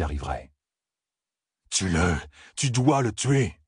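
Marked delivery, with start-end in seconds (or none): 1.7-3.6 insistance. Acted. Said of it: "insista Loustérine".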